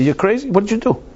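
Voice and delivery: high, exasperated outburst; alarmed scolding. Retold or imitated.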